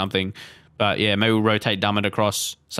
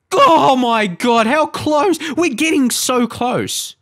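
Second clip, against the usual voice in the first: high, drawn-out near-miss cry; agonised excitement; 12 semitones higher, 5 dB louder, much slower than usual. Real, not acted. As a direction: stretch out the disbelief, starting loud.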